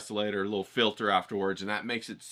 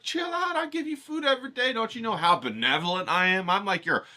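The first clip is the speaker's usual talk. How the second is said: much higher, half-shouted royal scolding; mock Raven King; 9 semitones higher, 4 dB louder than usual. Host scolding his ravens as their king, high mock shout. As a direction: lord it over the birds, pitched high and loud. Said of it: "you're like".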